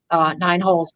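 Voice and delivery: ordinary delivery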